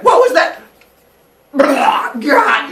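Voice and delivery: very high loud startled yell; startled shout. Retold or imitated. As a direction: jump at the boom and yell. Father Sky startled by boom.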